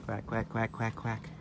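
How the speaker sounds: soft imitated duck quacks; duck quacking. Real, not acted. Woman calling ducks with soft imitated quacks. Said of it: "Do I sound like a duck?".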